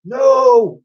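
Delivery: high, loud desperate no; scream. Acted. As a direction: scream to save your friend. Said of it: "screamed Elsie".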